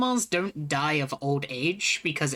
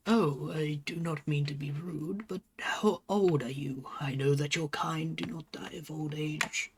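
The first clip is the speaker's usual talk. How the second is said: lightly voiced wise old turtle, unhurried; old turtle leader; 4 dB quieter than usual. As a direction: speak as a mild elder welcoming travellers, softer than table talk. Game master voices elder turtle leader.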